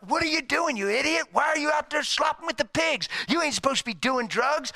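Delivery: loud, high-pitched berating shout; angry scolding. Acted. Preacher acts parent scolding addicted son, angry shouting. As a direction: berate him in a hammed-up yell.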